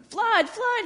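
high, loud false alarm; alarm cry. Acted. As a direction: shout a warning to scare them off. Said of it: "he cried".

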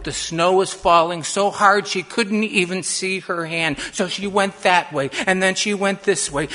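loud, urgent, breathless-style narration; panicked storytelling. A narrator's reading. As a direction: drive the chase forward at full volume. Girl lost, panicking in snowstorm.

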